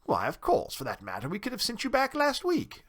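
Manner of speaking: mildly affronted, climbing high at the end; huffy town mouse, voice rising sharply; rather huffy. A narrator's reading. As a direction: retort with a touch of pique. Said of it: "said Johnny rather huffily".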